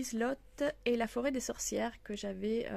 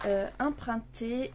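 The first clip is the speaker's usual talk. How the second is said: ordinary talk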